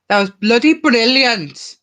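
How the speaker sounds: put-on lilting Welsh accent, sing it out slowly. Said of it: "I think that was a good Welsh accent".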